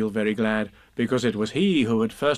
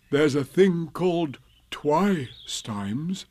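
slow, higher musing voice; dreamy, muddled Pooh; 4 semitones higher, much slower than usual. Acted. Pooh half-remembering an old lesson, slow and muddled. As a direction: feel your way slowly through the word.

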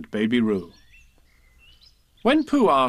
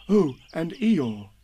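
slow, drawn-out afterthought, a little higher; dim, mild Pooh; 4 semitones higher, much slower than usual. A narrator's reading. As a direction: remember it slowly, like a simple bear. Slow Pooh remembers one more.

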